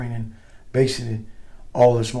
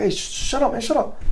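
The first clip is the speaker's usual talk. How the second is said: urgent hush; 13 semitones higher than usual. Retold or imitated. Buddies shushing him, retold.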